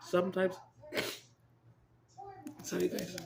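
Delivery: usual speaking voice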